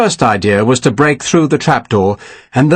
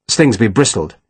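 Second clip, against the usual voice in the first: usual speaking voice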